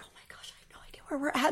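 whispered panic, hiding it from the room; panicked whisper. Retold or imitated. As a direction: whisper it so no one hears you're panicking. Panicking but acting cool.

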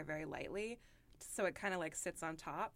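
ordinary talk

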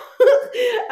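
loud, high laugh at the memory; burst of laughter. Real, not acted. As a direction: laugh out at the absurdity. Recalling sled going backwards.

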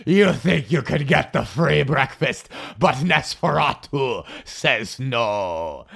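slow, creepy vampire voice; slow hammed-up Nosferatu taunt. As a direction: gloat like a creepy old vampire. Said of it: "internally, she was like".

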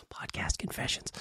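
unvoiced whispered aside. Real, not acted. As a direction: breathe it as a secret. Aside after a laugh.

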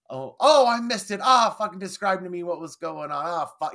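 imitating a flustered Brit in a British accent. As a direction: squawk it in a frantic British accent. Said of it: "And then taking the British accent".

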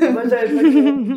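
high, loud words through laughter; laughing protest. Real, not acted. Partner claiming she was fooled, laughing. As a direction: protest while cracking up.

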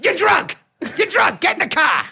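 high-pitched mock-furious shouting; hammed-up marital meltdown; exasperated spouse. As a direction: screech it at a drunk wife. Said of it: "When your wife".

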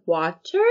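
stilted "water", lifted into a question; over-articulate "water", rising at the end; bad actor's deliberately wrong "water"; odd "water". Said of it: "why are they saying it wrong".

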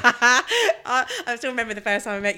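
bursting into laughter while starting to speak; laughing. Real, not acted. Reacting to the fluent Italian claim with real laughter.

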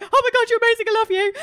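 loud, high-pitched starstruck gush; gushing fan. Retold or imitated. Makeup artist retelling her reply to idol, gushing loudly. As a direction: blurt it out in overexcited adoration.

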